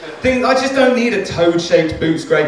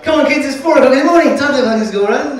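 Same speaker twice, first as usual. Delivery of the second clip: cheery parent voice; 9 semitones higher, 5 dB louder than usual. Pretend. Milkman parent wakes the kids.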